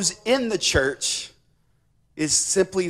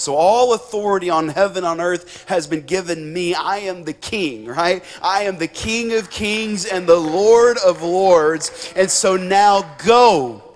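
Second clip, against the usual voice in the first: preaching as Jesus; 5 dB louder than usual. Acted. Preacher voices the risen King sending his disciples, forceful proclamation. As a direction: proclaim it with rising force, landing hard on the last word.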